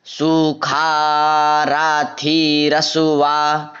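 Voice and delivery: सुखा राथी रसुआ chanted slowly and evenly; slow recitation. Shown as a demonstration. Teacher modelling the phrase for students, slow chant. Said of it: "रसुआ बोलना है".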